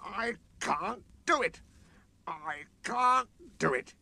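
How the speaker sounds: slow, high defeated grumble; grumbling. A narrator's reading. Sir Handel grumbling stuck on the hill. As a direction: grumble it slowly, giving up. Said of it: "he grumbled".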